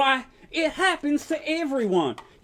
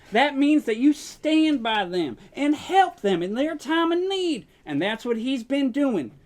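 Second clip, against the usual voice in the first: ordinary talk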